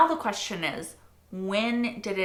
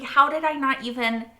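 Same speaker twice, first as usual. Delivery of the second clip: ordinary talk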